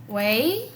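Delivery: "wei" as a long rising question; al recibir llamada. Shown as a demonstration. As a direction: slide "wei" upward, as answering the phone. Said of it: "cuando recibimos una llamada". («wei» largo y ascendente, al contestar una llamada)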